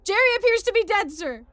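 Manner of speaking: loud, high drill-sergeant yelling; military shouting. Acted. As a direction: bellow it like a sergeant giving orders. Sergeant and private bark in story.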